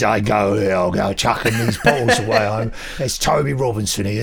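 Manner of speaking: hammed-up chummy geezer, mate; Toby geezer voice. Storyteller mocks posh Toby as a laughing cheeky geezer. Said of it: "I don't know why Toby's speaking like that".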